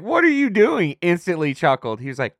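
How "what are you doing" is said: high-pitched imitation of a startled question; retold startled reaction. Friend retelling Jose's first reaction to the video, startled and high. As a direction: mimic his incredulous outburst, pitched way up.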